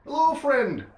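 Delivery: loud high friendly hail; calling back. Acted. Caravan driver calling out a hearty welcome. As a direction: shout a cheerful greeting back.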